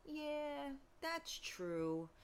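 a hint of a soothed sigh of agreement; mellow. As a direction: quietly give in, all chilled out. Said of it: "he'll make me all, like, mellow".

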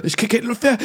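hoch und atemlos; panisch, nach Luft ringend; panicky. Retold or imitated. Repeated panic line. (erste panische Wiederholung)

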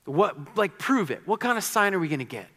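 mock demanding crowd wanting a sign. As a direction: play the skeptical crowd asking for proof. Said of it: "They ask".

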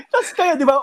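words spilling out through a laugh. Real, not acted. Friend asks through laughter about getting off the van.

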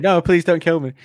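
high, loud whiny begging; mock pleading. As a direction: squeal for mercy in mock panic. Mocking friend's pleading.

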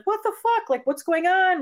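high-pitched, incredulous retelling of a confrontation; bewildered outburst. Retold or imitated. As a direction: act out her baffled demand, voice jumping up. Acting out the wife confronting husband.

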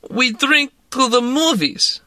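tipsy Russian woman urging a drink; Russian accent. A narrator's reading. Woman pressing vodka on narrator, in a Russian accent.

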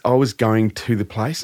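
ordinary talk

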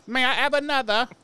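high, pleading little-orphan voice; mock Oliver Twist orphan. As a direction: beg like a Dickens waif, hammed up. Mock Dickens orphan line.

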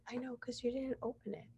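hushed, pointed imitated comeback; mock retort. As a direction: say it small and high, under the excuse. Her muttered retort to guests.

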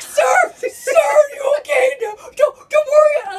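high, loud, frantic shouting; panicked worried shout. Retold or imitated. Neighbour calling to a dangling dummy, panicked high shout. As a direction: yell up in alarm at a man hanging off a roof. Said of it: "you could hear the worry in his voice".

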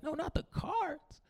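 high, soft, drawn-out mock whimper; small pitiful plea, hammed up; pleading. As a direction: plead feebly, as if heartbroken. Pleading for the cards, retold.

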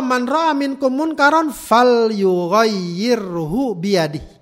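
slow, chanted Arabic recitation; hadith recitation. Acted. Preacher to congregation, slow chanted Arabic quote. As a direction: recite the Prophet's words slowly. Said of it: "kata Rasulullah".

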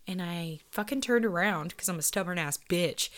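normal talking voice

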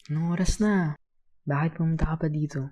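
voiced dialogue of a concerned kuya; concerned older friend. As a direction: speak up to a kid who shouldn't be here. Older boy questions crying child.

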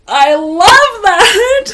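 high, loud and drawn-out delight; excited gush. Retold or imitated. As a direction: gush with delight, stretching every word. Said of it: "I was like".

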